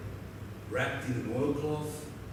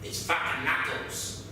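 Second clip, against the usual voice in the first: snarl. Retold or imitated. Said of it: "Fuck Knuckles snarled".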